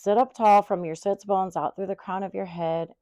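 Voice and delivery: usual speaking voice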